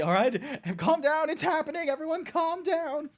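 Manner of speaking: high, mock-calming, overexcited; hammed-up boss hushing an excited office; Michael Scott. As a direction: pretend to calm everyone while bursting. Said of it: "Michael from The Office being like".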